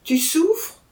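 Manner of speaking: husband crying out to his suffering wife; alarmed cry. A narrator's reading. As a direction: cry out in fear for her. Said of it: "s'écria-t-il".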